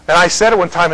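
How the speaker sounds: usual speaking voice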